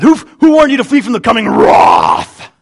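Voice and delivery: loud denunciation, ending on a booming "rawth" for "wrath"; fiery prophet's shout. Acted. John the Baptist warning the crowd, fiery shout. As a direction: spit the warning out with full force. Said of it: "John probably said that".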